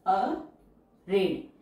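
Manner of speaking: Arid stretched out very slowly; slow teacher dictation. Shown as a demonstration. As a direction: draw out arid for students to follow. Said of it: "teacher says word then dictates".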